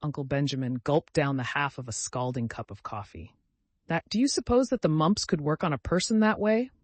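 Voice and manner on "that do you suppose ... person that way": high fretful wail; a touch of an anxious old cousin wailing; mild mock wail. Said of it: "wailed Cousin Stickles".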